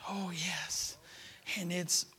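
a breathy whispered oh yes; whispered aside. Real, not acted. Preacher whispering to the congregation after the climax. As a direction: drop to a whisper.

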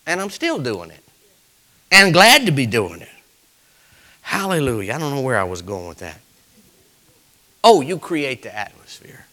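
ordinary talk

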